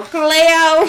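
high, wailing fake Australian; mock Aussie accent. Hosts recalling H two O, mock Aussie wail. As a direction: call out in a hammed-up Australian accent. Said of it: "then you go back to freaking H two O".

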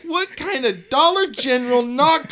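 loud incredulous rant; outraged rant. Retold or imitated. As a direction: rant at the cheap knockoffs. Ranting about cheap costumes.

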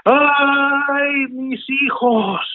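high, slow wailing cry; weeping ghost woman. Acted. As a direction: wail it like a mourning ghost. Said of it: "She went".

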